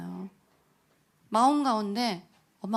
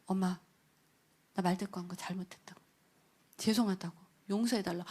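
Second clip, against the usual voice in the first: softer, lower, a touch of tears; remorseful plea; 4 semitones lower, 9 dB quieter than usual. Retold or imitated. Daughter phoning her mother to apologize, hushed and remorseful. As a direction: quietly beg forgiveness.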